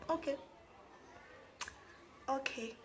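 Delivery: soft, subdued acquiescence; small quiet voice, backing down; meek compliance. Retold or imitated. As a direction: give in meekly, very quietly. Meekly giving in to threat.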